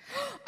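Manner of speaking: high mock gasp; a staged gasp of surprise; put-on gasp of dismay; feigned gasp. A narrator's reading. Goose drops the pebble.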